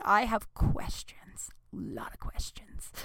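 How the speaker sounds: slow, hushed whisper. Real, not acted. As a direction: breathe it out slowly, conspiratorial. Mock-ominous whisper aside.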